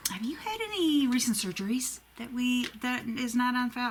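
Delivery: a lightly voiced puzzled nurse asking about surgeries; confused nurse. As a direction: play the baffled nurse checking a file.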